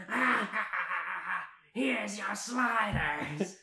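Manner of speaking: loud, slow, cackling villain voice; cackle, then announce it big and drawn out; villainess server. Acted. Villainess server handing sliders to customers, loud hammed-up villainy. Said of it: "She goes".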